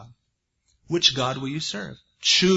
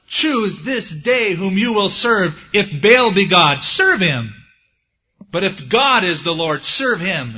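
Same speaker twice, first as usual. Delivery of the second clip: loud, slow prophetic charge; loud, weighty call to decide; proclaimed challenge; 4 semitones higher, 7 dB louder, slower than usual. Acted. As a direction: thunder the ultimatum to the nation. Acting Elijah's challenge to Israel.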